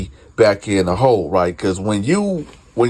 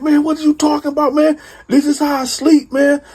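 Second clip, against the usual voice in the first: defensive cellmate. Retold or imitated. Cellmate defends himself, rattled.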